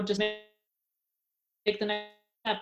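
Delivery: slow, soft and unhurried, a touch of a yoga teacher; calm teacher advice. Retold or imitated. Yoga teacher to struggling student, slow soft calm advice. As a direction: lightly voice the teacher's patient nudge.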